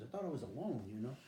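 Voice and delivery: ordinary talk, nothing marked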